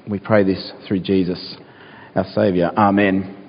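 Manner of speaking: a hushed, unhurried prayer to the Lord. Acted. Pastor praying before the Bible reading, hushed and earnest. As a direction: pray it low and humble, head bowed.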